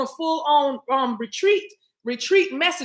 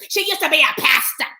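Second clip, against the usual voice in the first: quoting chat; 3 semitones higher, 6 dB louder than usual. Retold or imitated. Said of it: "I can hear these text messages".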